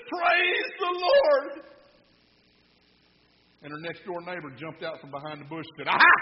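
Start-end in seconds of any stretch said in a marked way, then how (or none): 0.1-1.7 very high, drawn-out joyful holler; elderly church lady's high-pitched holler; exultant shout. Acted. Lady finds the groceries, rejoices. 5.9-6.1 sudden loud "aha"; triumphant pounce; gotcha shout. Acted. Neighbor jumps out from bush.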